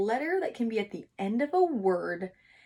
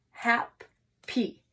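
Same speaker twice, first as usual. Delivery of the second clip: "Hap-py" said in two clearly separated beats; syllables split; 3 semitones higher than usual. Shown as a demonstration. Teacher splitting "Hap-py" into syllables for the class. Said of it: "let's say each syllable".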